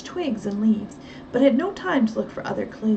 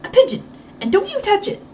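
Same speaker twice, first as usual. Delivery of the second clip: higher, louder quick warning; Effie cutting in sharply; 10 semitones higher, 7 dB louder, faster than usual. Acted. Housekeeper cutting in to warn the boy sharply. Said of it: "Effie cut in quickly".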